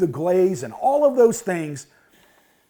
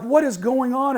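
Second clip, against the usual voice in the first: lightly acted, higher-pitched bewilderment; retold exclamation, voice raised in pitch; baffled outburst; 7 semitones higher than usual. Husband baffled by tearful family.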